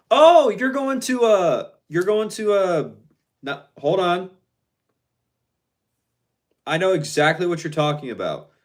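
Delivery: loud, high burst of recognition; excited realization. Real, not acted. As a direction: blurt it out as it dawns on you. Suddenly realizes which event is meant.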